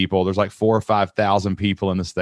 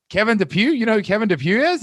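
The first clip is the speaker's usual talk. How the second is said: astonished; 12 semitones higher than usual. Retold or imitated. Retold astonished recognition.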